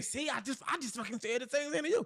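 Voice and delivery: half-earnest "see, I told you"; mock I-told-you-so. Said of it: "as opposed to me saying".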